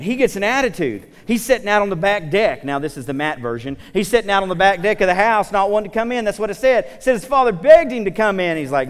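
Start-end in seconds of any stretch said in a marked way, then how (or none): none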